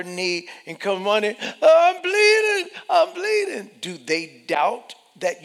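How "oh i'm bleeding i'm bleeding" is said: put-on very high loud wailing child; hurt child. Preacher voices a child running to parent, high wail. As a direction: wail like a child with a scraped knee.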